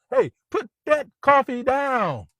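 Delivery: high, slow yell across the room; retold scream. Baldwin yelling at the salesman by the coffee, slow high shout. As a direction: bark the order at the coffee guy, drawn out. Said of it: "he screams across the room".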